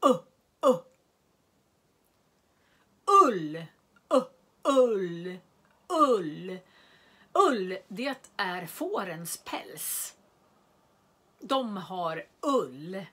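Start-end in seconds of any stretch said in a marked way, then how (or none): 0.1-7.8 u and ull sounded out loud and stretched; drawn-out u sound. Shown as a demonstration. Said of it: "U låter det som". (ljuda u och ull långsamt och tydligt)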